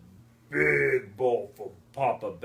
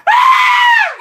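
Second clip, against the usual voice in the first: loud, very high story scream; ear-splitting high shriek; full scream; 24 dB louder than usual. A narrator's reading. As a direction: let out a piercing scream as the startled girl. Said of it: "and screamed".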